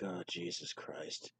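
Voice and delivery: low, slow mutter under the breath; quietly appalled, slowly; muttered dismay. Retold or imitated. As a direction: sink into a quiet groan of disbelief. Dismay at the cot placement.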